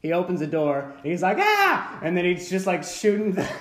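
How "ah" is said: very high, loud mock scream; startled yell, acted out; short panicky "Ah"; panicked scream. Technician screams on opening door.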